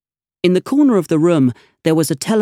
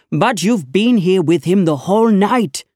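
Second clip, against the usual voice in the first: raised, higher accusing voice; shouted; 5 semitones higher than usual. Acted. Inspector scolding his constable, a raised higher shout. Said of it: "the inspector shouted".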